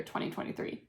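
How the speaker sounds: soft whispered aside; whispering. Real, not acted. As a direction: whisper the year to yourself. Aside after naming the year.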